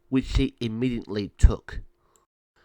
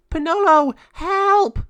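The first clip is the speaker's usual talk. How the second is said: high, loud shriek; terrified cry for help; 10 dB louder, slower than usual. Acted. Mariana caught in the raven's claws, terrified. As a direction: scream out to a friend for rescue.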